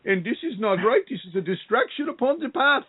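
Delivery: put-on high, singsong Indian accent, teasing; mock Indian guru voice; playful Indian accent imitation. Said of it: "if I can do my Indian accent".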